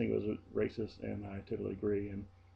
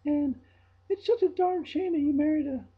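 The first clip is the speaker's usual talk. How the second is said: high, loud gushing ditzy voice; mimicking coworker; 9 dB louder than usual. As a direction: gush it like a clueless airhead. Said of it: "She was saying".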